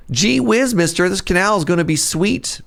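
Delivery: put-on high eager gee-whiz kid voice; wholesome 1950s kid. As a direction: wholesome schoolboy, wide-eyed. Kid voice acted for canal.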